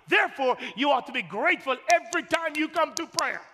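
loud, high shouting as God. Acted. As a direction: proclaim it from on high. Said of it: "He says".

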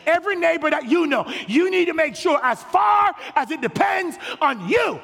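high, shouted, driving sermon lines; full-force preaching. Acted. Pastor warning the church against excuses, shouting. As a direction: hammer the point home to the pews.

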